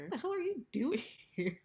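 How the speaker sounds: high, loud mock yell; hammed-up outrage; mock angry yell. Retelling angry Brock confronting Gallant.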